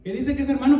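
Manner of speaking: ordinary talk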